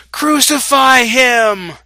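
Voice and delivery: high, drawn-out shout at full force; crowd's shout. A narrator's reading. The crowd answers Pilate about Jesus, shouting. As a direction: shout it out slowly like a demanding crowd. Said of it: "they shouted".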